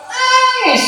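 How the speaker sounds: "nangis" jumps very high; wailed "nangis". Retold or imitated. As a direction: cry the word out in a high wail. Crying described, voice leaps.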